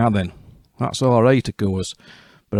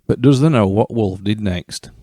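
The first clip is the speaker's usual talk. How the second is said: ordinary delivery, nothing marked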